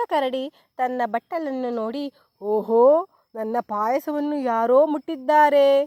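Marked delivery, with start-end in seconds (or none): 2.4-5.8 loud, gruff papa bear shout; storyteller's bellowing big bear. A narrator's reading. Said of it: "ಎಂದು ಒದರಿತು".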